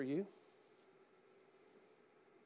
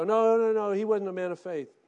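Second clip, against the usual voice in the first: mock objection; 4 semitones higher, 10 dB louder than usual. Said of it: "You may look at Samson and go".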